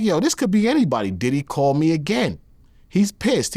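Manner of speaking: a hint of his ex, unconvinced; ex-girlfriend's voice.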